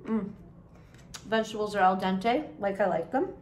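chewing while talking; speaking around food; mouth full. Real, not acted. Seen 1: Forkful of vegetables enters mouth at -2; jaw keeps moving while speaking.

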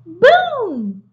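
loud, high booming shout; booming firework. Acted. Reader voicing the fireworks' boom, loud and high. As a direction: make it sound like a firework bursting.

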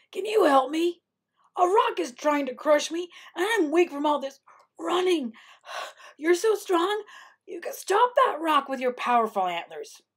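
high, panicked begging; pleading Coyote. A narrator's reading. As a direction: plead for rescue while worn out from running. Panicked Coyote begs deer for help.

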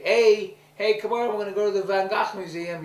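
loud, high pretend invitation; eager calling out. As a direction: call the child along, high and loud. Acts out calling the child along.

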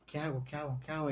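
repetido mucho más bajo, casi para sí; angustia callada. Retold or imitated. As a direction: se hunde en el estrés, en voz baja. Bloqueo al crear contenido. (angustia murmurada)

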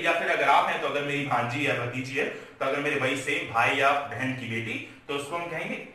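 ordinary talk

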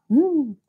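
high, falling ghostly ooh; mock-spooky ghost noise; playful high ghost wail. Spooky sound after mentioning ghosts.

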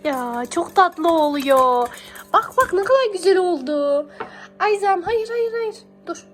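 usual speaking voice

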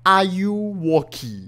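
slow, showy title read; slow title announce. Shown as a demonstration. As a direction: savor the title "Are You Walkie" slowly. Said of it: "这是什么游戏的名字来了？".

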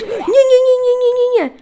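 very high, loud string of no; panicked shriek. Real, not acted. Enderman hitting her.

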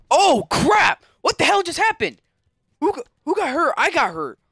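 high, loud startled reaction; shocked outburst. Real, not acted. Gamer reacting to a sudden knockdown, shocked outburst. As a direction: blurt it out in alarm, pitch jumping up.

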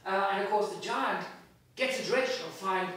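ordinary delivery, nothing marked

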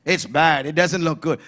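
a hint of a gloomy complainer; negative person. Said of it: "and he'll say".

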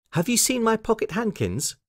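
put-on high, hopeful little girl; Lucy. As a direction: ask hopefully in a high child's voice. Lucy asks after lost handkerchiefs.

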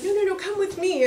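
excited high call to come along; urgent invitation. Retold or imitated. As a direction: pull him along with you, high and insistent. Rival local outbidding the first.

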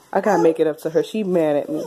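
ordinary delivery

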